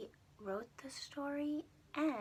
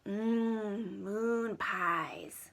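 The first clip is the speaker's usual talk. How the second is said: dreamy craving; 10 dB louder, much slower than usual. Acted. Hungry Jimmy savors thought.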